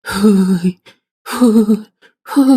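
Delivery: short, spaced eerie "huu" moans; ghostly woman's moan. Retold or imitated. Friends hear a woman in the blackout, ghostly moaning. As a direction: imitate a ghost woman sounding through the dark. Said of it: "ឮសំឡេងមនុស្សស្រី".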